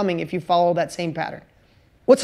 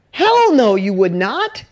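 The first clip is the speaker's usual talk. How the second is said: emphatic hell no; 4 dB louder, slower than usual. Real, not acted. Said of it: "emphatic answer".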